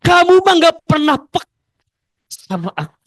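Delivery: loud whiny grumble at the husband; loud complaint that fades out; grumbling complaint. Retold or imitated. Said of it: "Bunda ngedumel sama suami".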